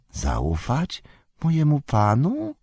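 high, slow wary creature voice; distrustful. A narrator's reading. Golum doubting his master's request for trust, distrustful question. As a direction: question it suspiciously, drawing the words out high. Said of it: "nieufnie spytał Golum".

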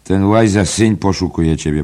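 quick warning. Acted. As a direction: warn him urgently, half in jest. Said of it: "Szybko, ostrzegawczo".

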